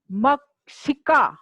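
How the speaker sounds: मक्षिका drawn out slowly, with the क्ष cluster stressed clearly; model pronunciation. Shown as a demonstration. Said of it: "बोलिए". (मक्षिका का धीमा स्पष्ट उच्चारण)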